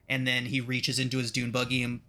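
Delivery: normal talking voice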